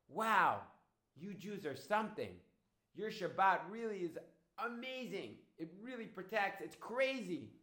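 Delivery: high, exclaiming wonder with a loud opening; amazed. Acted. Woman telling Dodi her Shabbat protects, amazed.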